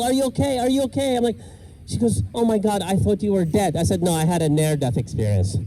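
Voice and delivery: usual speaking voice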